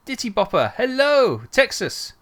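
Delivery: high, loud welcome; excited greeting. Real, not acted. Streamer welcoming a viewer who just arrived, excited and loud. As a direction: call out a big, excited hello.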